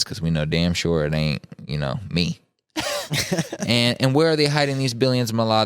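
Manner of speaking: usual speaking voice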